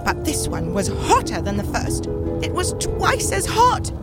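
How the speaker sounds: pitched high, dramatic storyteller's distress; mounting distress. Acted. Teacup tells of the second oven, acted distress. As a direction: recount it as a teacup in growing dread.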